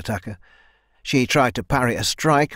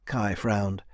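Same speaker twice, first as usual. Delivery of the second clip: usual speaking voice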